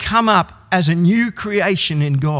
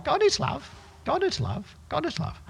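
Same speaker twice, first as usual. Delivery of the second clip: much higher, softer squeaky bird voice; budgie voice; 10 semitones higher, 9 dB quieter than usual. Retold or imitated. Budgie reminding the family all day, high bird voice. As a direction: repeat it like a pet bird. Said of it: "reminding you all day long".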